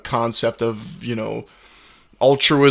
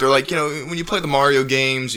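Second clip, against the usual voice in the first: usual speaking voice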